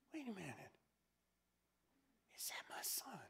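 whispered, disbelieving question; father whispering in hopeful wonder; hushed wonder. Acted. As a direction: breathe it as if you can't believe your eyes. Father spots son far off.

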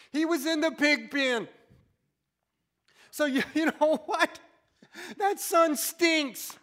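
loud preacher's rise; loud impassioned sermon; preaching at full force. Acted. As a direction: preach it to the back pews.

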